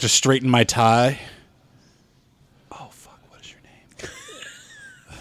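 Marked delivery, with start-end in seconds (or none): 2.7-5.2 barely audible, slow whisper; voiceless mutter under the breath; whispered aside. Acted. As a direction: whisper it to yourself while searching your memory. Forgets a name, whispers aside.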